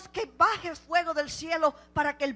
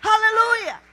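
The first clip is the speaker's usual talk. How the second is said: much louder, driving proclamation; fervent preaching shout; 4 semitones higher, 8 dB louder than usual. Acted. Preacher rousing the congregation, loud shout. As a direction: hammer it home to the church.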